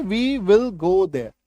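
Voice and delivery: very slow dictation, stress on will; slow classroom read; slow model sentence. Shown as a demonstration. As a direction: spell it out slowly. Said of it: "वहां की अंग्रेजी देखिए".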